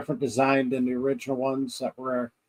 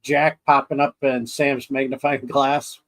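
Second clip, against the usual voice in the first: ordinary talk, nothing marked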